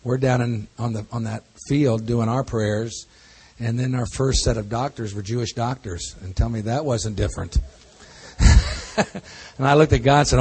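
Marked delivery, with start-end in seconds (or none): none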